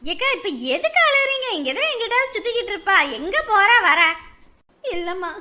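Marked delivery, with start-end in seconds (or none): none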